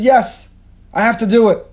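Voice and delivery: high, loud, firm answer; resolute declaration. Acted. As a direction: insist with full conviction. Avram answering his own doubt.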